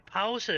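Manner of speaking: two-syllable Danish pause with ow; Danish pronunciation. Shown as a demonstration. Explaining the button on your keyboard, Danish pronunciation of pause. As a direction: pause as "POW-seh". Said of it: "It's pronounced pause".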